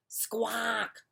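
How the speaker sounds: breathy whispered squawk. Acted. Rooster huffing at Duck, whispered squawk. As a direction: hiss the squawk out unvoiced. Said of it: "said Rooster".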